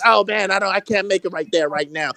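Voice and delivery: lightly voiced limp excuse; mock excuse. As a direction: mimic a weak excuse, quietly. Mocking the other guy's excuse.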